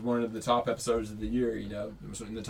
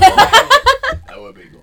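laughing; 19 dB louder, much slower than usual. Real, not acted. Laughing reaction.

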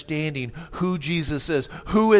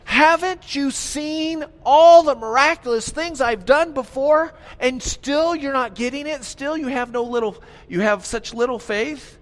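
high, loud, pleading rebuke; exasperated Jesus; 8 semitones higher, 4 dB louder than usual. Acted. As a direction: plead with them, frustrated they still don't get it. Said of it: "So Jesus says".

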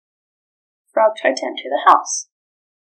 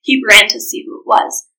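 usual speaking voice

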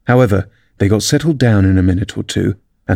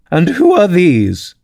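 high, drawn-out haughty demand; high-pitched, slow royal demand; imperious Queen; 8 semitones higher, slower than usual. A narrator's reading. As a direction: question them slowly like a domineering queen. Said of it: "said the Queen".

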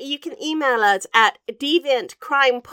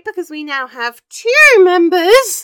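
louder, higher, proud announcement; excited boast; 6 semitones higher, 9 dB louder than usual. Real, not acted. Host telling listeners about the Facebook group, excited. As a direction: crow over a tiny milestone.

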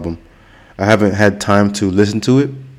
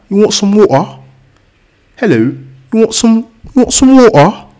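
much higher, louder put-on British accent, water without T or R; 12 semitones higher, 5 dB louder than usual. Vlogger mimics an English person offering some water, in a British accent. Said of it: "in England, they say water".

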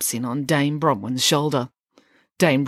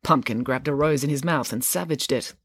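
ordinary delivery

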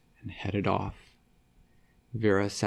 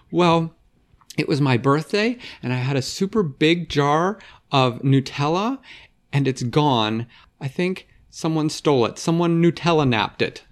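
put-on upset girl; 5 semitones higher, 5 dB louder than usual. Vera reports her stolen Nutella.

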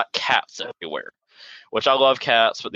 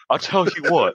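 Hank Hill catchphrase; 6 semitones higher than usual. Retold or imitated. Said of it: "just like Hank Hill".

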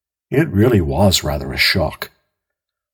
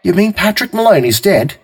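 higher, brisk phone voice; policeman on the line; 11 semitones higher, 3 dB louder than usual. A narrator's reading. Police officer asking who calls, lightly voiced. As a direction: ask it briskly.

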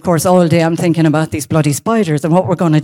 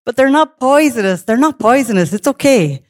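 higher-pitched, reassuring monk imitation; imitated monk brushing off the fear; amused monk; 6 semitones higher than usual. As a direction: make light of it in a raised voice. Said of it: "they continue laughing".

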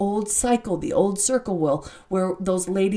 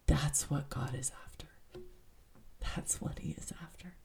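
near-whisper, much softer and lower; hushed; 4 semitones lower, 15 dB quieter than usual. Real, not acted. Host telling listeners what God is after, hushed whisper. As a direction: let it drop to a reverent whisper. Hushed emphasis closing a read passage.

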